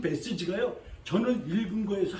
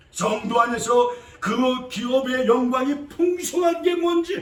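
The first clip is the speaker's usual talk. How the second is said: slow, louder proclamation; preaching shout; 3 semitones higher, 6 dB louder than usual. Real, not acted. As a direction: lean into every word.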